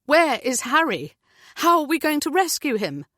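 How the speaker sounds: pleading for her son, voice climbing; frantic fearful mother; desperate. A narrator's reading. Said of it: "her heart racing".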